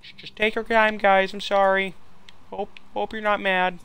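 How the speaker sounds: high put-on sorry voice; apologetic neighbour. Neighbour hoping they aren't mad, high apologetic voice. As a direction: plead high and meek. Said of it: "if he's Canadian".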